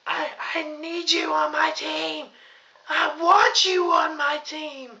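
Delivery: very high mocking screech; Alicia Fox. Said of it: "her voice, ah, like, I don't".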